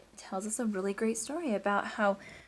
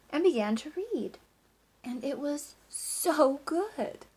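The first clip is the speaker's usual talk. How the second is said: lively, sing-song storybook reading; reading to children. Acted. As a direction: read it like story time for a class. Teacher reads picture book aloud.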